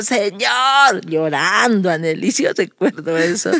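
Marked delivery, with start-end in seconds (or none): 0.0-1.0 high, drawn-out mock cry; retold wail to God; imitated tearful shout. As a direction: call out as another child praying. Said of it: "Está gritando el otro".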